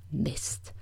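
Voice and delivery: muttered under the breath, whispery; grumbled curse. A narrator's reading. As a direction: grumble it softly to yourself. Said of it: "brummte er". (leise gebrummt)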